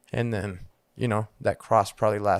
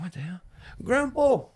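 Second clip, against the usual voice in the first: put-on little kid; 9 semitones higher than usual. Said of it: "You know, I'm a little kid".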